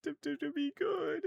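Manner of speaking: high stammering sob; sobbing clown. Acted. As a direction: break down pleading to be good. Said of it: "it starts sobbing".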